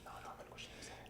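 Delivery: rapid hushed whisper; fast conspiratorial whisper. Shown as a demonstration. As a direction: rush it under your breath. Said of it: "you pretend to be Cliff".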